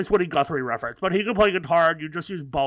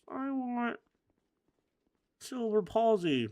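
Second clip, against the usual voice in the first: put-on small high child voice; soft, slow, high-pitched little kid; child Timmy; 8 semitones higher, 5 dB quieter, slower than usual. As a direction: play a little kid making a wish. Acts child Timmy answering parent.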